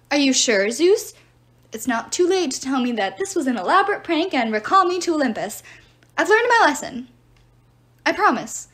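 loud, desperate plea upward; pleading to the sky. Acted. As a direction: beg Zeus loudly.

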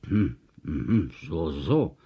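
low, pondering hum; brummend. A narrator's reading. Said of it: "brummte Herr Kukurux". (tief und bedächtig gebrummt)

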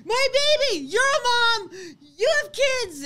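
imitating a frantic mother, high and loud; mock-hysterical wail, pleading for help; high, tearful retold outburst; hysterical screaming, crying. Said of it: "screaming, crying. Hysterical".

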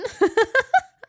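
high, loud giggling fit; high laughing fit; burst of laughter. A narrator's reading. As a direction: crack up laughing.

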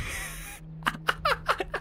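breathless wheezing laugh, very high; helpless laugh at the screen; real high wheeze of a laugh; squeaky unvoiced laugh. Real, not acted. Laughing at the boss.

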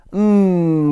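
loud, high, drawn-out "mmm"; wheezing doctor. A narrator's reading. Doctor Plump finishing the buns, wheezed relish. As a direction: savour it long and loud. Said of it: "wheezed Doctor Plump".

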